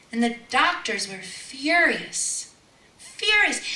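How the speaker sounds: emphatic. Real, not acted. Preacher describing furious doctors, loud emphatic. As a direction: hit furious hard. Dramatic retelling.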